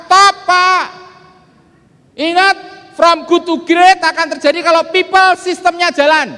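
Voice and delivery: loud, high, slow motivational preaching; fiery seminar sermon; full-force preaching. Acted. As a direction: drive it home to the crowd.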